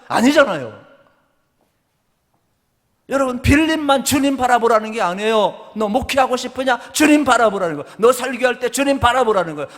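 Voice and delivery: high, loud preaching with rising fervour; full-force preaching. Acted. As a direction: preach it out to the congregation.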